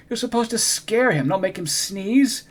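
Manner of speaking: scolding. Acted. Mister Z scolding Morgan.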